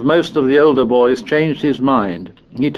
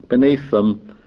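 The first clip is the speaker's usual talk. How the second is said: usual speaking voice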